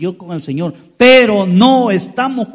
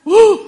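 sudden much higher, louder whoop of delight; joyful whoop; 13 semitones higher, 7 dB louder than usual. Acted. Preacher acting out a lottery win to the congregation, joyful whoop. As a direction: yelp like you just won the lottery.

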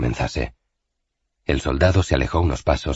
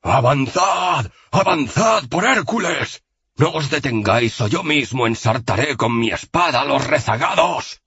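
thundering merciless orders; 11 semitones higher than usual. A narrator's reading. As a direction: roar the orders without mercy. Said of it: "su voz atronadora e inmisericorde".

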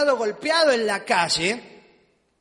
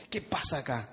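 much lower, quieter mock-macho challenge; mock tough guy; 10 semitones lower, 9 dB quieter than usual. Pastor joking to the congregation about his bravery, mock low tough-guy voice. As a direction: pretend to be the brave one, low and deadpan.

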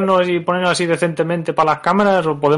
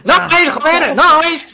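mock shout; 10 semitones higher than usual. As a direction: shout it out, ribbing your friend. Mocking co-host's excitement.

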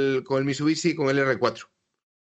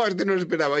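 amused, laughing as you talk; 5 semitones higher, faster than usual. Real, not acted. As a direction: laugh then speak through a chuckle. Reacting to a funny photo.

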